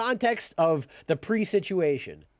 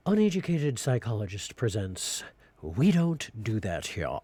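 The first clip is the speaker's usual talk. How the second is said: low, deep trailer-announcer voice; movie trailer announcer; 11 semitones lower than usual. Acted. Presenting his skit title, deep trailer voice. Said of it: "mock title announcement".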